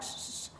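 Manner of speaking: soft imitated shushing; hushing. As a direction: shush them as he did. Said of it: "signaling for us to be quiet".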